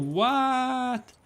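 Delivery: high, long drawn-out "what"; astonished. Real, not acted. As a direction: stretch it out in amazed disbelief. Reacts to surprising potassium fact.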